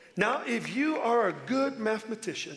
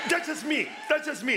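much higher, laughing call to the crowd; laughing shout; 7 semitones higher than usual. Real, not acted. Joking about his own sermon.

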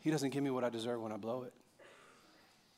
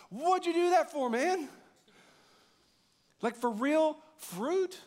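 exasperated scolding; 5 semitones higher than usual. Retold or imitated. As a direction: chew Adam out over the fruit. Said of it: "look at Adam and go".

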